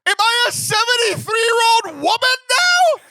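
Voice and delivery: outraged mock disbelief; loud comic indignation; indignant outburst. Retold reply to mammogram question.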